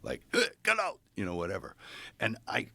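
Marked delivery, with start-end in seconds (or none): 0.3-1.0 sharp high mock yell at the liver; a high yelled "get out"; retold yell. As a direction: bark it out, pitched way up. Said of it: "he started yelling at my organ".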